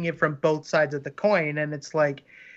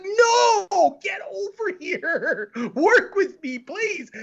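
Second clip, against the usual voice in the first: mock pleading; 11 semitones higher, much slower than usual. Mock begging the legendary animator.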